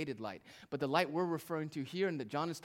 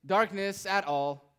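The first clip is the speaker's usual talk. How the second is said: HIM and darkness at all said high and loud, slowing at the end; louder reading; 6 semitones higher, 8 dB louder than usual. Shown as a demonstration. Preacher testing a louder reading on the congregation. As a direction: lift it up and slow the last words. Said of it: "How about this?".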